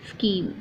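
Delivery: "scheme" said as "skeem"; silent H. Shown as a demonstration. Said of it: "right pronunciation of this word".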